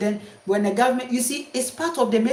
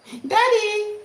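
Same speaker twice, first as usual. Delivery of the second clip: put-on child crying out; 12 semitones higher than usual.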